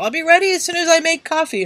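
quoting a friend. Retold or imitated. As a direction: read the message like she said it. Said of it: "I get this message from Death Roll".

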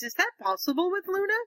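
high-pitched, lightly acted query; doubtful question. He asks Ginny about quarrelling with Luna, mock doubtful. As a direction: quote him asking, pitch lifted in doubt.

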